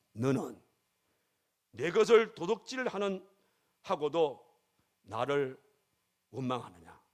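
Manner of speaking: slow, halting rebuke with pauses between phrases; weighty, measured question that drops away on 원망하느냐; God's rebuke. Acted. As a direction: deliver God's reproach piece by piece, falling at the end. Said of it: "하나님께서 그 여인에게, 그 집사에게 깨달음을 주신".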